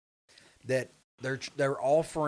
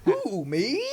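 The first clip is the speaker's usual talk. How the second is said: high, loud, hammed-up surprise; pitched-up mock innocence, breaking into laughter; 8 semitones higher, 5 dB louder than usual. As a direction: tease with put-on astonishment, laughing. Mocking friend's reply to vendor.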